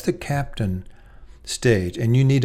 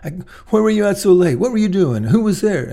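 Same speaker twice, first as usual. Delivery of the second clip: louder, higher, firing accusing questions; interrogating parent; 8 semitones higher, 6 dB louder than usual. Retold or imitated. Parent grilling teen about late night, loud and accusing. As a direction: grill them like an inquisitor. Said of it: "not as an inquisition".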